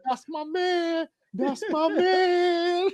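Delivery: high, slow, cracking voice; high crying praise. Pretend. Cheering the gazelle's move to a friend, high slow mock wail. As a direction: wail it like a proud fan.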